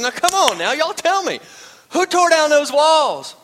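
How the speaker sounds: a flustered pastor demanding an answer; mock exasperated shout.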